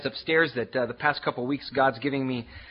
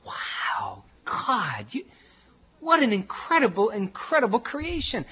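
awed wonder; 7 semitones higher, slower than usual. Retold or imitated. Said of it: "and it's like".

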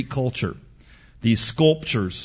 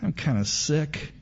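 put-on queasy little girl; 4 semitones higher, 8 dB quieter than usual. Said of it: "she just goes".

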